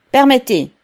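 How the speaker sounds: high, loud call to pass; curt request. A narrator's reading. The director parts the crowd, calling out high and loud. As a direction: ask the crowd to make way.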